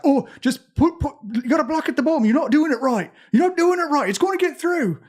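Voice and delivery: put-on high, loud, panicked English accent; fretting urgently in a put-on English accent. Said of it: "I did the wrong accent for it".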